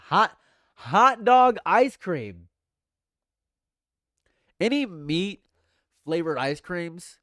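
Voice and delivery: loud, high and slow shriek of disgust; horrified disbelief. Real, not acted. Streamer reading hot dog ice cream off the list, loud and appalled. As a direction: drag the words out, appalled.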